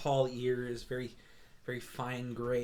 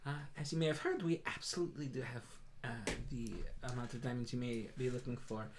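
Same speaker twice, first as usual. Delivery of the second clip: higher, bright-pitched welcoming shop cat; Moon the Tabaxi shopkeeper; 3 semitones higher than usual. Acted. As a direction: greet customers grandly in a high voice. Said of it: "she turns around and goes".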